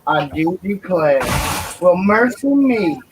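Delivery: put-on Southern Belle accent. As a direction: drawl it out slowly like a fainting Southern belle. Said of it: "my Southern Belle".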